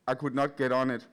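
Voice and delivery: ordinary talk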